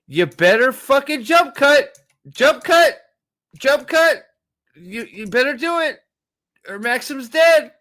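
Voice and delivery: high, loud yelling at the screen; frantic urging yell; excited yelling. Real, not acted. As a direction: shout your demand at the screen. Yelling at the show's editing.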